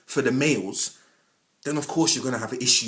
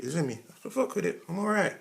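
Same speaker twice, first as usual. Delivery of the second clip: smug reply; 4 semitones higher, 6 dB quieter than usual. Pretend.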